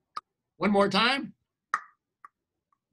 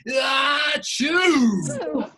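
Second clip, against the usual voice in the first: big shouted achoo rising at the end; loud group sneeze; 7 semitones higher, 5 dB louder than usual. Shown as a demonstration. Storyteller leading the audience in a loud sneeze. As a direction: lead the kids in a huge sneeze. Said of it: "Ready to sneeze with me?".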